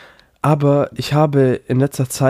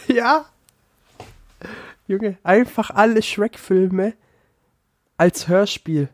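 excited; 9 semitones higher, slower than usual. Real, not acted. Gleeful reveal of Shrek audiobooks.

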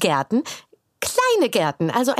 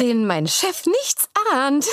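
ordinary talk, nothing marked

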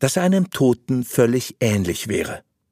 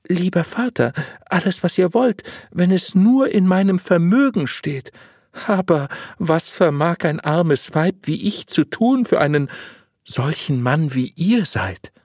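higher-pitched obliging woman; humble, willing wife; 7 semitones higher than usual. Acted. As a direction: pitch it up as an eager, modest wife. Voices the wife promising reward.

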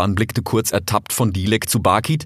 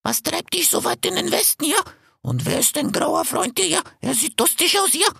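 much higher, rasping vulture voice; hoarse vulture. A narrator's reading. Vulture Bakit questioning the fox, hoarse. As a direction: croak it out like an old vulture. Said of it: "sagte Bakit heiser".

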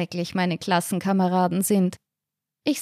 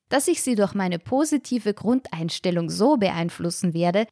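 ordinary delivery

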